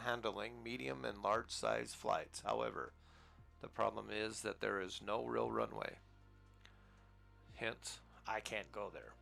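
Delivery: normal talking voice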